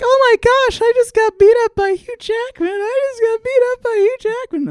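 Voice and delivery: very high, loud fanboy squeal; hammed-up ecstatic yelling at the top of the voice; starstruck thrill. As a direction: shriek it like an overjoyed teenage fan. Teen thrilled at being roughed up.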